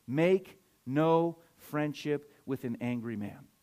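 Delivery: slow, measured, word-by-word quote; spaced-out, weighty Bible verse; slow, deliberate scripture reading. Real, not acted. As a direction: read it slowly, pausing on each word.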